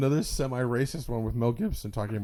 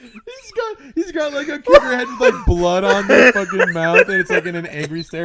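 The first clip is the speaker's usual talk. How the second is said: ordinary talk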